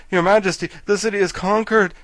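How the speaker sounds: gasped out. Acted. Soldier warns the king, loud breathless alarm. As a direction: blurt the news in a panic. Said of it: "gasped the royal army, who was all out of breath".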